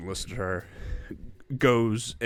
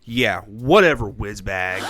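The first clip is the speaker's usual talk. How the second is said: slow, louder mock cool-kid sneer; Disney Channel acting; 7 dB louder, slower than usual. Mocking cool-guy child actors, hammed-up Disney Channel delivery. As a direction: ham up a too-cool teen brushing someone off. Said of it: "it's like Disney Channel acting where they're like".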